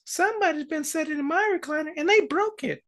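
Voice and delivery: very high little bear cub voice; Baby Bear. Acted. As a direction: squeak out a small cub's complaint. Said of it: "Baby Bear says".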